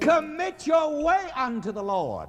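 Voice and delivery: high, emphatic reading of the verse; preaching the text. Acted. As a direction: lift the verse for them to echo.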